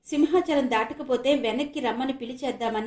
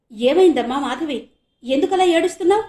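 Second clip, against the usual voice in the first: alarmed; 6 semitones higher, 9 dB louder than usual. Acted. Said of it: "గాభరాగా అడిగాడు".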